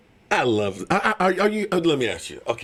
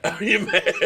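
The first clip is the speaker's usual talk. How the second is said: usual speaking voice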